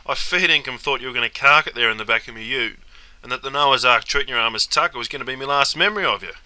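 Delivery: normal talking voice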